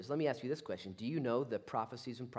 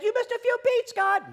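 loud, high-pitched shouted complaint; mock accusation; 14 dB louder than usual. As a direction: challenge God at full volume. Acted argument with God.

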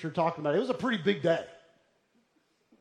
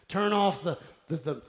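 ordinary talk

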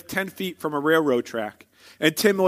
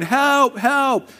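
retold yell for help; 7 semitones higher than usual. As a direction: imitate a kid calling for help. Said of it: "I remember yelling".

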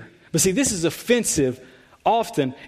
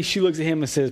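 normal talking voice

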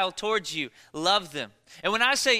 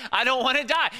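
higher, louder comic protest; emphatic protest; 7 semitones higher, 6 dB louder than usual. Acted. Preacher answers why we fear flying, emphatic protest. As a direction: blurt it out as a mock-fearful protest.

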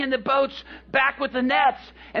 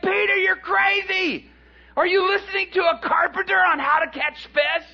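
jeering disbelief; 8 semitones higher than usual. Acted. Fishermen jeering at Peter's carpenter advice, high shouting.

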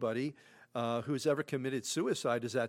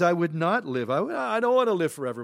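raised, rushing cry of despair; anguished cry; 5 semitones higher, 9 dB louder, faster than usual. Acted. Job crying out to God that he won't live on, anguished. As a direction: blurt it out fast and loud, fed up with living. Job voiced, refusing life.

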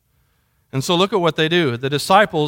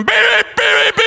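very high-pitched rapid beeps; piercing, insistent beep mimicry; imitated blaring alarm; 14 semitones higher, 3 dB louder than usual. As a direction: shriek out beeps like an alarm up close. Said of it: "I walk into his room".

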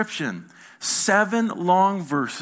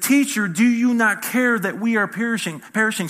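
raised, louder plea of alarm; 7 semitones higher, 5 dB louder than usual. Acted. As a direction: cry out to the teacher for help. Disciples waking Jesus in the storm.

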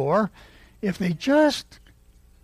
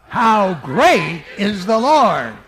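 slow, loud praise; real loud; 3 semitones higher, 7 dB louder, slower than usual. Shown as a demonstration. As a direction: call it out to the congregation. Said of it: "Three times".

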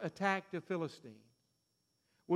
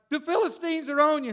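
crying out; 8 semitones higher, 7 dB louder than usual. Retold or imitated. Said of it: "Then she cries".

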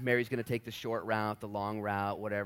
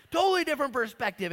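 ordinary talk

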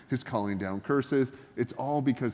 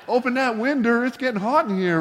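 put-on Jesus with southern accent; 12 semitones higher, 6 dB louder than usual. Preacher joking about a country-talking saviour, hammed-up southern accent. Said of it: "Jesus talks like he's from the deep south".

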